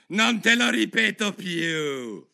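slow, high, loud royal rage; furious yell. A narrator's reading. Enraged queen threatening the Duchess, furious yell. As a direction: yell it furiously, stretching the words. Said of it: "urlò la regina battendo il piede rabbiosa".

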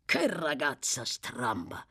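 slow, low, lightly voiced rough character; exasperated exclamation. A narrator's reading. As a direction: grumble it slowly in mild exasperation. Sykes exclaims about the odd girl.

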